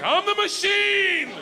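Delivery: high, loud, slow declaration; drawn-out triumphant yell; proud shout. Retold or imitated. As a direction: announce yourself as the machine. Shouted catchphrase in doorway.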